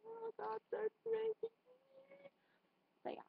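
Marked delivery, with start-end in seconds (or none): 0.1-1.5 higher panicky aside; higher pitched oh my God. Retold or imitated. Telling how she reacts to geese, higher panicky aside. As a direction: squeak it out in mock alarm. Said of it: "I kinda go like".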